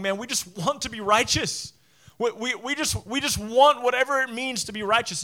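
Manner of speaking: desperate crowd voice climbing to a cry; pleading crowd. Retold or imitated. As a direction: beg for it, rising as you go. Crowd voiced longing for righteousness.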